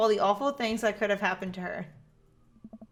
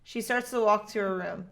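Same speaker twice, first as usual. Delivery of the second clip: ordinary delivery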